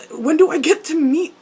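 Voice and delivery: loud, high, insistent plea; eager parent. Acted. Parent begging to meet son's boyfriend, eager and loud.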